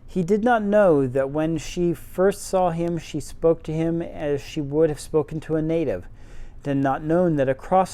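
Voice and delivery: usual speaking voice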